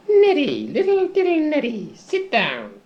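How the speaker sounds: high sing-song cooing over Neddy; mock-fond high coo. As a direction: coo his name like a pet. Boss coos over Neddy.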